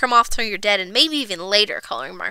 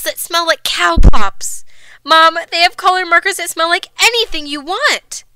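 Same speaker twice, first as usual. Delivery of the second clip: put-on higher, louder excited little girl; pleading child; 4 semitones higher, 6 dB louder than usual. A narrator's reading. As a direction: kid begging mom, gushing.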